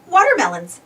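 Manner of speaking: ordinary delivery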